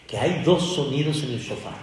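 normal talking voice

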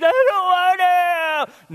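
put-on high, loud, slow whining child; whiny child. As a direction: drag it out like a sulking kid. Kid whining about brushing teeth.

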